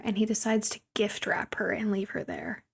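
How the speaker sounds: softly whispered, like sharing a sneaky secret; whispered aside. Acted. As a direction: drop to a whisper for the sneaky part. Whispered aside in movie recap.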